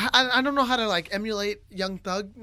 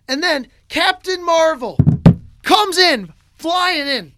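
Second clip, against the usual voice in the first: loud, raised, punchy hero announcement; hyped dramatic reveal; 7 semitones higher, 10 dB louder, slower than usual. Retold or imitated. Dreamer retelling Captain Marvel flying in, hyped reveal. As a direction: build it up like a big superhero entrance.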